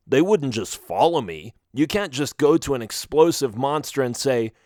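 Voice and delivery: plain reading voice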